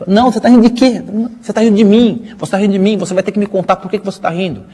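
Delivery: high, insistent mock demand; hammed-up nagging questioning; acted indignant wife. Wife's acted quarrel with husband.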